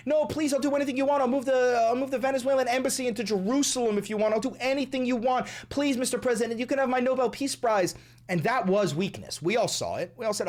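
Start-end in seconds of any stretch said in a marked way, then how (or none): 0.1-7.9 high, hurried mock pleading; mock begging. Host mimics opposition leader begging the president, mock pleading. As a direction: plead desperately in a high mocking imitation. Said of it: "on her knees begging".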